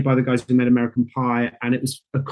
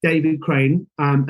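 ordinary talk, nothing marked

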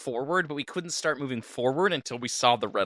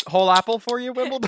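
high, sweet horse talk; 12 semitones higher, 5 dB louder, faster than usual. Pretend. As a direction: coo to a pet horse. Talking to horse.

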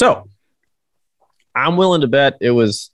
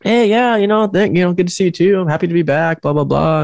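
polite chirpy reply; 8 semitones higher than usual. Retold or imitated. Self-quote replying politely.

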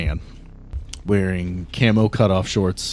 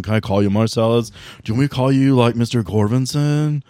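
excited vape bro; 3 dB louder than usual. Acted. Vape bro gushing to Marcellus about his ride. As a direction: hype up his cloud ride.

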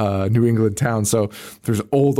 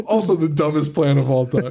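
laughing; 3 semitones higher than usual. Real, not acted. Laughing while finishing the story.

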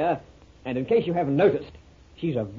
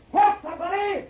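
much higher shout for help; calling out. Acted. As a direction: yell across the waterfront. Friend calls for help.

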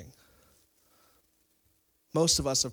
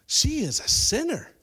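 scornful accusation; 11 semitones higher, 4 dB louder than usual. Acted. Pharisee judging the sinful woman.

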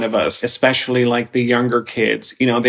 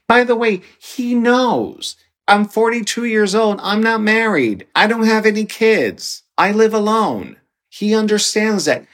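raised insistent voice; 9 semitones higher than usual. Retold or imitated. Retelling a firm point to mother.

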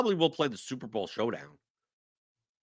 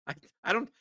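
ordinary talk, nothing marked

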